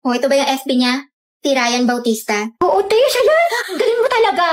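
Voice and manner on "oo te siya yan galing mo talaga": excited gush. Acted. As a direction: praise her friend, thrilled. Thrilled she found his profile. (kinikilig na papuri)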